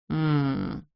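drowsy, low hum; half-asleep murmur; sleepy drawl. A narrator's reading. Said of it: "сонно протянула Терри".

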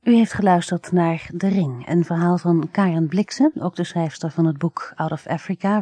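plain reading voice

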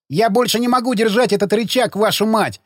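high strained yell. Acted. Zagorsky yelling that he cannot hold the lever. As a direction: bellow it out, arms giving way. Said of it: "заорал Загорский".

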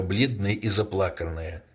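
usual speaking voice

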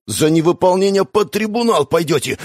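almost break into a shout; near shouting. A narrator's reading. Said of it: "едва не сорвался на крик".

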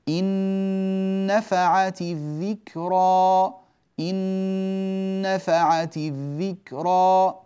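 إن نفعت الذكرى chanted slowly, the two nuns merged into one held nasal; Quranic recitation demo. Shown as a demonstration. Teacher reciting the verse slowly to show the merged nun. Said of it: "فتدخل النون في النون".

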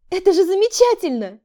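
high, loud, thrilled cry; delighted exclamation. Acted. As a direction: burst out in delight. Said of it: "воскликнула я".

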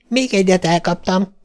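put-on high, excited child voice, mild squeal; lightly voiced squeal of triumph. A narrator's reading. Little girl downing pirate planes, mildly squealing. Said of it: "visított Glória".

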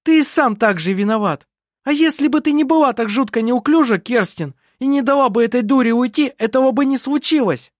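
agitated, indignant reproach; angry blaming. A narrator's reading. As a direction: lash out at both accomplices. Lisin blames Adrian and Kerstin.

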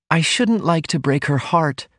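mildly troubled, thoughtful woman; pensive. A narrator's reading. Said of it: "Ruth said pensively".

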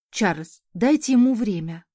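usual speaking voice

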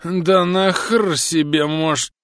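put-on high, slow drunken angry outburst. A narrator's reading. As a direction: start an angry drunken rant. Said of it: "сердито начал техник".

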